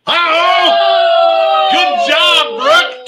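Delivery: loud, high uh-oh of delight; a startled, happy cry at the late bid; surprised shout. Real, not acted. Auctioneer caught off guard as Brooke bids at the buzzer, shouting.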